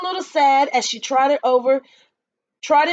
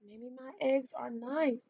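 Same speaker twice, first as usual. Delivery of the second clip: softer, wistful sigh of a line; sighing softly; 15 dB quieter than usual. A narrator's reading. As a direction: let it fall away with a sigh. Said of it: "Dora gave a little sigh".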